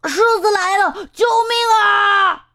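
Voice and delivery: high frightened shouting; terrified cry for help. A narrator's reading. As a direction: scream for help, a lion is here. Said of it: "变色龙吓得透不过气来".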